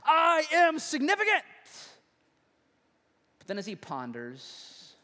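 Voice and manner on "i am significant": loud, high scream at the universe; screamed proclamation. Acted. Cartoon boy yelling at the starry sky, screamed. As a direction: shout it skyward with full force. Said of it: "he screams out at the universe".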